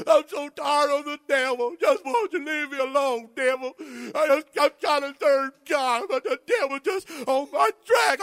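whiny pity-party voice, lightly voiced; hammed-up pity party whine; mock self-pity. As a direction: play the sorry believer feeling sorry for himself. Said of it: "I'm gonna have a pity party".

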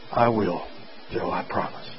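hushed, breathy whisper of a promise. A narrator's reading. Doctor promises dying Joe, in a hushed whisper. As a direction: whisper it at a deathbed.

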